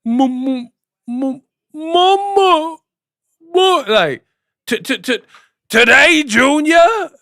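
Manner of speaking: high, loud stuttering slow voice; Simple Jack impression. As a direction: stammer through it, pitch way up. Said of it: "he be on his Tropic Thunder".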